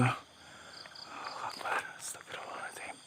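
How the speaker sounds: faint, voiceless real whisper; whispered prayer. Real, not acted. Explorer after something appeared in front, whispered prayer. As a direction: breathe the words out, barely audible.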